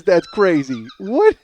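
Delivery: ordinary delivery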